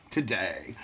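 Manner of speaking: low quiet voice. Acted. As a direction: sink it down low and soft. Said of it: "where I do voices".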